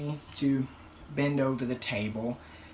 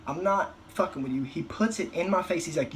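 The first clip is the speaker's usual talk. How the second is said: usual speaking voice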